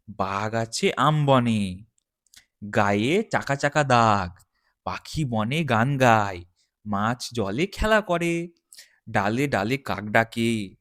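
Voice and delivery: put-on sing-song recitation of a children's primer; teacher chanting a school rhyme line by line; reading to children. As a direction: recite each line clearly for young pupils. Teacher recites Sahaj Path rhyme.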